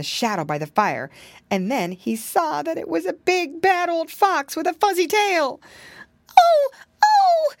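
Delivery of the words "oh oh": very high, loud frightened piglet cry; a piglet realising he'll be eaten; squealing terrified little pig; frightened gasp. Acted. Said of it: "gasped the little piggy boy".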